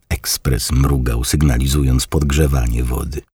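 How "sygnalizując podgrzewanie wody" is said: plain reading voice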